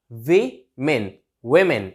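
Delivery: women split as "वी", "मेन", then joined; plural women pronunciation. Shown as a demonstration. Teacher showing how to say it, syllable by syllable. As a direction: break women into two syllables, then blend. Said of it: "ऐसे बोलना है".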